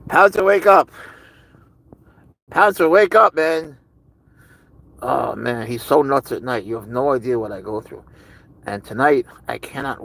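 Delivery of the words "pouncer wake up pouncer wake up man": loud, high wake-up call; raised voice, trying to wake him; calling to wake pet. Real, not acted. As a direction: call out sharply to rouse a sleeping pet. Calling sleeping Pouncer to wake.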